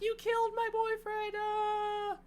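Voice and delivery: hammed-up girlfriend screaming at her boyfriend's killer; mock shriek. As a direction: shriek it like a horror heroine, overdone on purpose. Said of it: "Allison's like".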